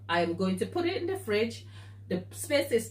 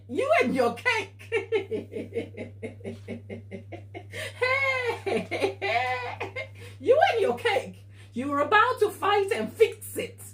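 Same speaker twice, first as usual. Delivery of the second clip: high-pitched, laughing and half-crying as she tells it; real laugh-cry breaking into every phrase; laughing through tears; 10 semitones higher than usual. Real, not acted. Baker recalling her lonely three A M cake disaster, laughing through tears. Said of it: "the whole situation still brings back the emotion".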